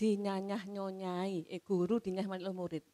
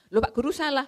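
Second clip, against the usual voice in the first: a sharp, raised "you're wrong, sir"; blunt accusing; 6 semitones higher, 6 dB louder, faster than usual. Retold or imitated. Pupil bluntly telling the teacher he is wrong, accusing. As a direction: call out the teacher bluntly, a bit higher and louder. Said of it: "bukan, nada tanya".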